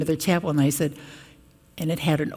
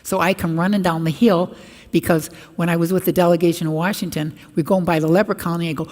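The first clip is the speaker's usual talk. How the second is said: usual speaking voice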